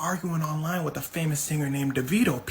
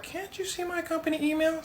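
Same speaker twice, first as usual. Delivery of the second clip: high-pitched, softer pleading; coaxing scammer; 11 semitones higher, 5 dB quieter than usual. Retold or imitated. Scammer persuades Sarah he is in the company, coaxing. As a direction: coax her in a high, softened voice.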